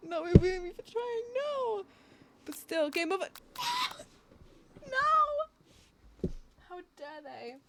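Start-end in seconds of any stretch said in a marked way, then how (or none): 0.1-1.2 high, slow, laughing whine; laugh-crying through the words; laughing protest. Real, not acted. Laughing at the game over. 1.3-6.3 anguished no. Real, not acted. Game over, loud dismay.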